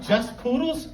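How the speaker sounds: mock offended just poodles?, rising; indignant retort. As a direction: throw her words back at her. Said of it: "I said to the woman".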